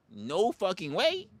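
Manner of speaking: ordinary talk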